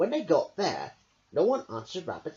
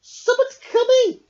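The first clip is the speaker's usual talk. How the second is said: ordinary delivery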